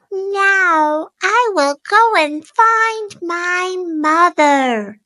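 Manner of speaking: a small hopeful chick; baby bird voice. A narrator's reading. Baby bird sets off walking to find mother, high. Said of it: "he said".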